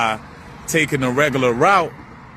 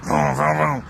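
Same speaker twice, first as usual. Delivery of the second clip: quick "vroom" engine noises; imitated car engines. Vlogger mimicking all these hurried cars, imitated engine noise.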